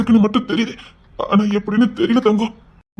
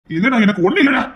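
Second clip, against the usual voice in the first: ordinary delivery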